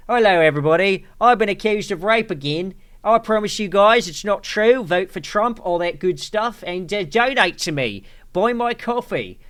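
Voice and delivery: broad mock Cockney sales patter; Russell Brand, Cockney accent. Streamer parodying an accused star's video greeting, mock Cockney accent. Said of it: "He has a Cockney accent".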